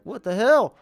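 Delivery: mock outraged teammate. Gamer voicing a confused player, mock shocked. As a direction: blurt it like a stunned lineman. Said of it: "Warner just was like".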